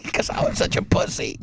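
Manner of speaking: breathy self-mocking aside; half-laughing whispered confession. Real, not acted. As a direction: drop the voice to an embarrassed whisper.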